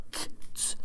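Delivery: "k, ts" as short voiceless consonant bursts, no vowel; consonant sounds. Shown as a demonstration. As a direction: spit out "k, ts" as bare hissed consonants. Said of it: "the vowels that are K, R, S".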